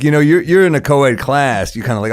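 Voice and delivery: ordinary delivery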